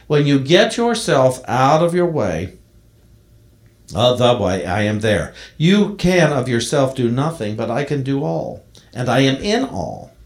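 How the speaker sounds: solemn preaching of a poem, God's voice swelling loud; poem recital. Acted. Minister reading the poem to the congregation, solemn and swelling. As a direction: declaim each line as God speaking, rising and falling.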